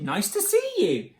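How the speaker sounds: high, welcoming hoot; cheery high greeting call. A narrator's reading. Duckbills greet newcomer Drip, high happy hoot. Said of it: "they hooted".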